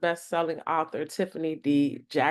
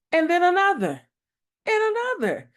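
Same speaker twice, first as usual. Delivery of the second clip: each one higher and louder; building excitement; 13 semitones higher, 5 dB louder than usual. Acted. More and more questions popping into Deja's head, rising higher. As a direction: pile it up, rising in pitch.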